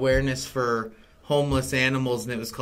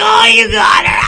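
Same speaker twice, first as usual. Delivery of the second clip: cheering shout; 14 dB louder than usual. Retold or imitated. Other child cheering.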